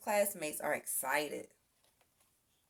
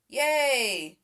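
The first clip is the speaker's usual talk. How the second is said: loud happy yay; cheer; 4 semitones higher, 7 dB louder than usual. A narrator's reading. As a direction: cheer like the rescue worked. Fire is out, cheer.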